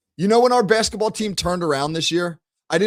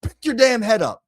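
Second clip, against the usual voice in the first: sharp raised coach yell, higher pitched; yelling at player; 6 semitones higher than usual. Retold or imitated. Coach yelling at Steve about his body language, raised and sharp. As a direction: bark it at the kid on the court. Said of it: "and I yelled at him".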